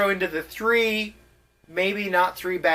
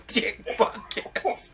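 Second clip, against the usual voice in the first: laughing; 3 semitones higher, 5 dB quieter, much slower than usual. Real, not acted. Laughing through the name.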